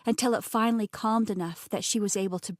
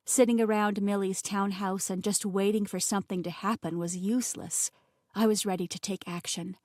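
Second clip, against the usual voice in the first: ordinary delivery, nothing marked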